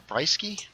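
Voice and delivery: "Brisky" said as BRY-skee, questioning; asking the pronunciation. Shown as a demonstration. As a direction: say "Brisky" with a long I, like "eye". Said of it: "Brisky or Brisky?".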